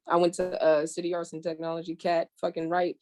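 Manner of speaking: ordinary delivery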